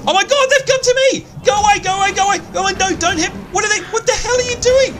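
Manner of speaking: shrieking at monsters to go away; panicked screaming. Real, not acted. Yetis attack her.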